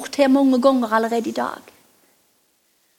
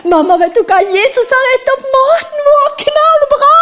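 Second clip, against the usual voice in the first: put-on much higher, loud, bursting child's voice; boy rushing home to tell his mother, shouting with glee; excited boy telling mum; 11 semitones higher, 6 dB louder than usual. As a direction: blurt the news to mum like a thrilled kid. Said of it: "si det til mammen min".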